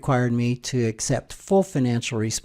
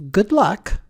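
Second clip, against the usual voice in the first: imitated curt clerk, higher and louder; higher, louder brush-off in imitation; curt retort; 8 semitones higher, 5 dB louder than usual. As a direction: toss it out curtly, pitched up. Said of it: "She curtly added".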